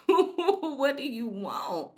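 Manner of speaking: slow, high, stretched-out whine of a question; drawn-out exasperated ask. Retold or imitated. Annoyed son drawing out his question to mom and police, exasperated. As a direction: draw out the impatient question to the cops.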